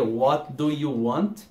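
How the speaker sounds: very slow, every word spelt out; slow careful English. Shown as a demonstration. Teacher showing the correct full form. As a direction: form each word the textbook way. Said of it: "Que seria".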